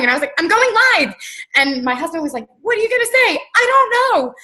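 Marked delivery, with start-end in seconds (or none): 0.4-1.1 high-pitched excited announcement; giddy re-enacted outburst; retold shaky excitement. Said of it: "I was shaking". 2.6-3.4 very high-pitched mock question; high, hammed-up retold query; imitating startled husband. Husband questions her sudden live broadcast. 3.6-4.3 very high-pitched, frantic reply; high, hammed-up clueless outburst; retold giddy excitement. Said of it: "I was just so excited".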